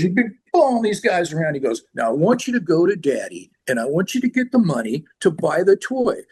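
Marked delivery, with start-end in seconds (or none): 0.0-1.5 high, pushy mock lawyer; crass lawyer. Crass band lawyer to the A and R guy, pushy. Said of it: "like a John Madden kind of guy". 1.9-6.2 high, insistent mock instructions; crass lawyer. Crass lawyer sends A and R guy to daddy, insistent. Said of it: "he was this really crass too".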